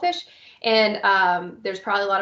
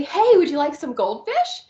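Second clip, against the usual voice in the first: high, bright and slow, a little louder; cheerful offer; 5 semitones higher, 4 dB louder than usual. Shown as a demonstration. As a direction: offer the snack brightly to a small child. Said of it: "I'm gonna say".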